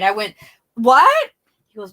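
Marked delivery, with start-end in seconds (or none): 0.7-1.3 sudden high, loud shocked yelp; shocked what. Retold or imitated. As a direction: blurt it out in disbelief. Shocked reply to news.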